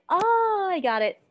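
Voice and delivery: loud, high oh of sudden understanding; eager aha moment; excited realization. Shown as a demonstration. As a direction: burst out with the oh. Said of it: "if you are really".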